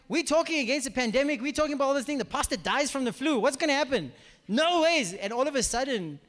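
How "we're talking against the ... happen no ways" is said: high, urgent protest; indignant disbelief. Retold or imitated. Preacher retelling thoughts on the pastor dying, indignant. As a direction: argue it out, pitched up and agitated.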